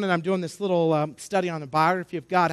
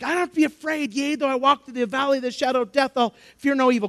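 higher-pitched, quick recital; lightly voiced kid; 6 semitones higher than usual. Camper answering the teacher's question, lightly voiced kid. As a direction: recite it like an eager pupil. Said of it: "this other kid's like".

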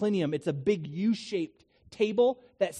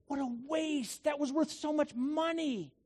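upset Judas; 8 semitones higher, 5 dB quieter than usual. Acted. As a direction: act out Judas appalled at the waste. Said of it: "he's really upset".

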